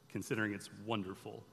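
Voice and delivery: hushed. Retold or imitated. Angel's cryptic answer.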